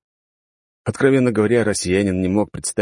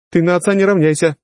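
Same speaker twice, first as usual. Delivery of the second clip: high, louder strict telling-off; stern scolding; 12 semitones higher, 6 dB louder than usual. Acted. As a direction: scold the son firmly. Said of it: "строго отчитала".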